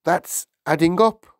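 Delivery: high, loud schoolboy protest; flustered pupil objecting; crying out. A narrator's reading. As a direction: cry it out in alarm. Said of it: "Wilfred cried".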